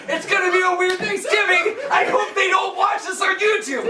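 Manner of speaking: high, strained shouting through laughter; real pained outburst. Real, not acted. Comic ending the set while being shocked, crying out high. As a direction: push the sign-off out high through jolts.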